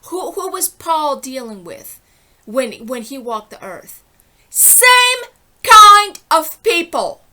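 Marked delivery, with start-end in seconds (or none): 4.5-7.2 high, loud, slow drawn-out shout; real shout. Real, not acted. Berating people who have not evolved: slow, high, loud shout. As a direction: hurl it at them, slow and huge. Preaching rant, phrase shouted back.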